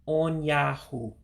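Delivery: on Yahoo drawn out syllable by syllable; teacher's slow on Yahoo; slow model word. Shown as a demonstration. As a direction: model on Yahoo slowly for the class. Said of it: "So again".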